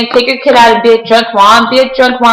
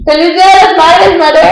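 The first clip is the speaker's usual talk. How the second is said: higher, happy Mother's Day greeting; cheerful wish; 7 semitones higher than usual. Real, not acted. Son wishes his mom happy Mother's Day, higher and cheerful.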